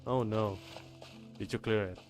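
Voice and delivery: ordinary talk, nothing marked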